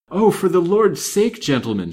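high, loud, frantic plea; frightened maid imploring; panted plea. A narrator's reading. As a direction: beg the men desperately to stop. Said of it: "she panted".

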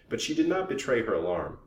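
ordinary delivery, nothing marked

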